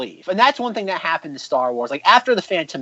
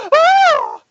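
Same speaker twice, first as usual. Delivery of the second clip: very high, loud collective gasp; mock crowd gasp; 6 dB louder than usual. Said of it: "the entire crowd goes".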